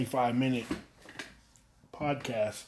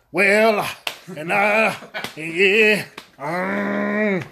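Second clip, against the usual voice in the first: loud, high preacher voice; hammed-up old school preaching; 6 semitones higher, 5 dB louder, much slower than usual. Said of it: "old school".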